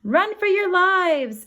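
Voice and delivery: high, loud panicked scream. Acted. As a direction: scream it in terror. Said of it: "a girl screamed".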